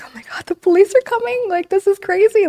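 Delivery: high, frantic panicked voice; imitating her own high-pitched panic. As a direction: squeal it out in disbelief as the police come. Panicking as the police arrive.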